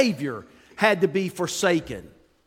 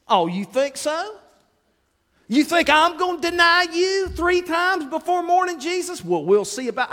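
pitched-up, challenging "oh, you think so?"; defiant dare; 10 semitones higher than usual. Retold or imitated. Preacher playing himself daring Jesus, high defiant taunt. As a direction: taunt back like a stubborn kid.

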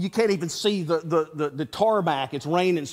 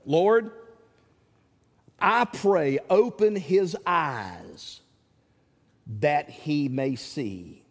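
calm prayer; 5 semitones lower, much slower than usual. Retold or imitated. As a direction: pray calmly as Elisha would. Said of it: "Elisha calmly offers the prayer".

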